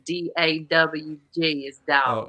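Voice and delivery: slow spelled-out demo of dog; spelling Southern dog. Shown as a demonstration. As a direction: slowly spell out D A W G, then say dog. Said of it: "how to spell speaking Southern".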